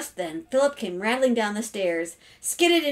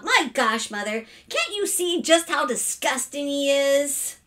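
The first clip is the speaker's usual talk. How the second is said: put-on raised, indignant girlish complaint; disgusted sister; 6 semitones higher, 3 dB louder than usual. Sister complaining to mother about her brother, disgusted. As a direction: appeal to mother in revulsion.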